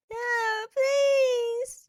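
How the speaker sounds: high, tearful plea; crying and begging. Acted. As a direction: beg through tears. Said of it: "Jane was crying and begging".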